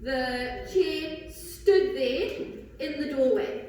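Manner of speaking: slow, deliberate reading; slow example read. Shown as a demonstration. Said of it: "I could say".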